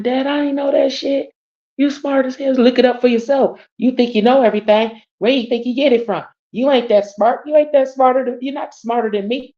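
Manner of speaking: playful argument with dad; heated retort to dad. Retold or imitated. Arguing with dad, retold quote.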